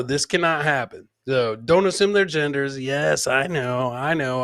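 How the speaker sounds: ordinary talk, nothing marked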